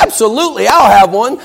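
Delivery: high, eager acceptance; breezy and clueless, happy for a treat; a late student grabbing a free cupcake; cheerful yes. Acted.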